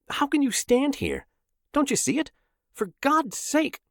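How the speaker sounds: high, strained disbelief at the crowd; pleading with bystanders who see nothing; frantic outburst. A narrator's reading. Frantic man pleading with the crowd, high and strained.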